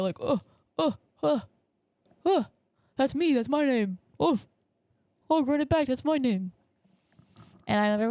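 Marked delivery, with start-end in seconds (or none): 0.2-6.5 higher-voiced mock excited grunts; Leonardo DiCaprio. Podcaster spotting own name in film, mock excitement. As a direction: point and exclaim in short bursts. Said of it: "it was the Leonardo DiCaprio".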